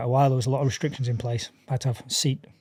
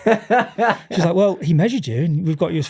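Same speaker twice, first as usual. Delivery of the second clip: words broken by laughter; laughing through the story; 5 semitones higher, 5 dB louder than usual. Real, not acted. Retelling the absurd call, laughing.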